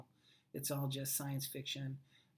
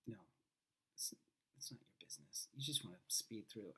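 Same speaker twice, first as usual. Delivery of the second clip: whisper; 6 semitones lower, 12 dB quieter than usual. Pretend. Aside while playing with effects.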